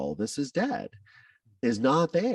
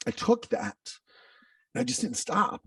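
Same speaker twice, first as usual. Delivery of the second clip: fighting tears. Real, not acted. Recalling his childhood trauma on a podcast, voice breaking. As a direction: voice cracking high, holding back tears. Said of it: "I'm trying not to get emotional".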